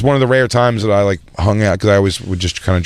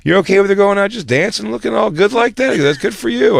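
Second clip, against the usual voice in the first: a higher, sly creepy dad; leering dad voice; 10 semitones higher than usual. Retold or imitated. Dad in the back seat teases his son, high and sly. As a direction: lean in and needle the driver. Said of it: "He's like".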